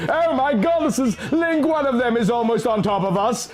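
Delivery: high, frightened cry to Link; mild mock scream. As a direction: call out in panic as the dragon dives. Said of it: "I screamed".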